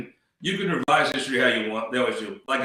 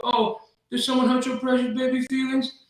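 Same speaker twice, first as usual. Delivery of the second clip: very high mock-pity voice; Gussie; 12 semitones higher than usual. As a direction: taunt a whiner in a high, babying singsong. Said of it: "my Gussie picked up on that".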